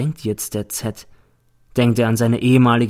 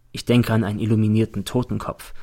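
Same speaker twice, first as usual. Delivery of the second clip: ordinary delivery, nothing marked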